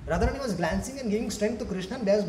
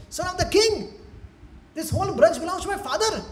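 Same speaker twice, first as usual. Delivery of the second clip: high, emphatic bragging; self-important boy's proclamation; puffed-up boast; 9 semitones higher than usual. Acted. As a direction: lord it over your playmates in a raised pitch. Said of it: "puffed up guy".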